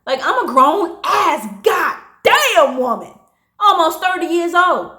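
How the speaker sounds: high, loud, emphatic outburst; real indignation. Real, not acted. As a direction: vent your outrage, leaning hard on the words. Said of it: "I feel angry just thinking about it".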